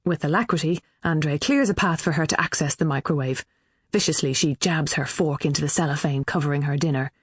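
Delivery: ordinary delivery, nothing marked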